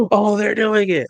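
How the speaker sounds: put-on fan amazement; imitating excited fans. Voices excited fans seeing costumes.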